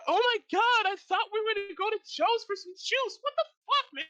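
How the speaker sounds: put-on high, loud panicked girl's voice; terrified woman. Bystander woman panicking at the laser. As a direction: shriek it out like a scared young woman. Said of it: "She's like".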